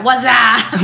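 drawn-out "wassup" catchphrase; goofy American bro hello; American greeting spoof. Pretend. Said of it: "What's the American of that?".

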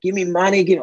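high-pitched imitation of ranting; mock ballistic demand. Angry Russian biker demanding money after the crash, mock ballistic. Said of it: "just starts going off".

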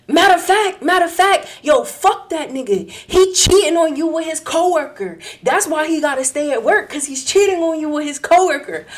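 loud, high-pitched outraged outburst; so mad, acted out. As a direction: blurt out the betrayal, imitating a furious friend. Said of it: "he was so mad".

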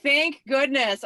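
slow, loud sigh-like relief after being laid off; relieved. Retold or imitated. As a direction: stretch it out like a weight just came off. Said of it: "I was just kinda like".

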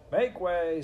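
loud, high crowd-clearing call. Acted. Furlow calling out through crowd.

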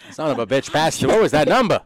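put-on loud, high, exasperated old-man act; hammed-up cantankerous caller; cranky old man. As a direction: play a flustered old man cursing on voicemail. Acting old man cursing on voicemail.

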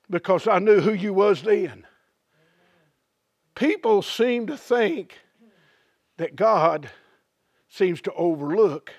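ordinary delivery

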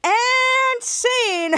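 high, drawn-out "and scene"; playful showy announcement; theatrical sign-off. Pretend. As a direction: sing out the end of the performance. Announces end of acted recap.

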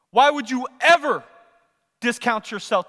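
loud, high challenge with EVER punched; impassioned preaching. Acted. Preacher challenging the women of God, impassioned and loud. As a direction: throw the question out hard.

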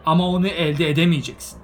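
loud and high, furious; angry defiance. A narrator's reading. Bilbo angrily refusing to give Gandalf the ring. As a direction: throw it in his face.

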